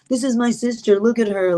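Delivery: retold brother's dig; sarcastic. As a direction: present your sister with mild sarcasm. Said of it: "his so sarcastic".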